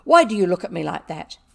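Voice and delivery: very loud, indignant challenge; fierce demand. A narrator's reading. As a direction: demand an answer angrily. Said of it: "she demanded".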